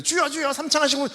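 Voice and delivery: high, loud cry of "Lord, Lord" like a revival pastor; pastor's loud call. Retold or imitated. Pastor calling three hundred people into loud prayer, high loud cry. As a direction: belt it out like a pastor leading prayer. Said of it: "거기서 목사님이".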